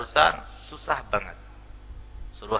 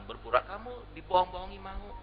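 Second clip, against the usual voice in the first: ordinary talk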